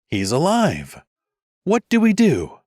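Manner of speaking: high, urgent panic; alarmed. Acted. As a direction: cry out in alarm. Alarmed mage over fallen Leif.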